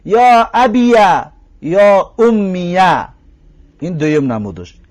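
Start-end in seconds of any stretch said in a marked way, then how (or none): 0.1-3.1 «یا ابیه» slow, with an open "ya" (fatha on the ya); fatha on ya demo. Shown as a demonstration. Teacher shows students the fatha form, slow and clear. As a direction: stretch «یا ابیه», leaning on "yaa".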